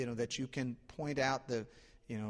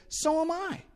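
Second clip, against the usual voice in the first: eager agreement; 7 dB louder than usual. Retold or imitated. Joke: eager agreement with jumper.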